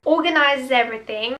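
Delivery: ordinary delivery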